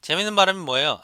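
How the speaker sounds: usual speaking voice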